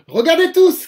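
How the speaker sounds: loud, high cry of a showing-off puppet; triumphant shout to the audience; shouting proudly. Acted. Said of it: "crie-t-il".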